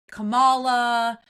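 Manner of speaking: "Kamala" slow and loud, stressed on the middle syllable, ka-MAH-la; mock-wrong "Kamala", leaning on a long second syllable; deliberate mispronunciation. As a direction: drag out the middle of "Kamala" mockingly. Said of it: "intentionally trying to mispronounce her name".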